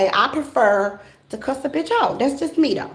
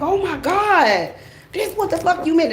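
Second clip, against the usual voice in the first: amazed realization; 8 semitones higher than usual. Retold or imitated. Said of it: "and be like".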